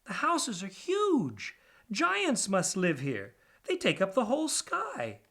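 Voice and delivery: high, slow, wide-eyed rat voice; awed little pack rat. Acted. DJ gapes at the skyscrapers, high and slow. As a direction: stretch each word out in wonder.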